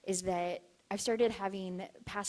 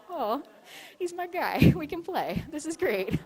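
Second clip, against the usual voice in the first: high, loud, laughing delight; 9 semitones higher, 9 dB louder than usual. Real, not acted. Hearing the spirit box say fun, gleeful and loud. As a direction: gush with glee over the answer.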